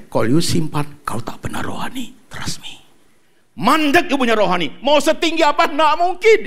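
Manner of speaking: normal talking voice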